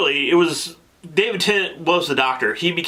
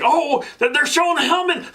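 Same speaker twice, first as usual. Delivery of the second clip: much higher, shouting out the monster's name; excited fanboy squeal; 12 semitones higher than usual. Retold or imitated. Husband to wife during the show, excited high shout. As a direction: squeal with delight at husband's telly reveal. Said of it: "I was like all excited".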